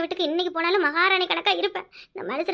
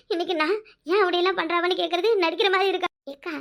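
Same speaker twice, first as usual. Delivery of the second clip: normal talking voice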